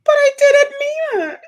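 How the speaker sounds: put-on high, loud tearful child's plea; crying child. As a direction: plead like a sobbing little girl. Toddler crying.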